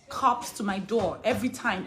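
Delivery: usual speaking voice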